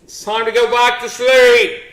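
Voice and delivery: usual speaking voice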